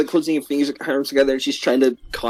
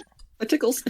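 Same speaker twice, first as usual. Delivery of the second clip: put-on high, soft giggly child voice; giggly little girl; 9 semitones higher, 6 dB quieter than usual. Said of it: "I'm like".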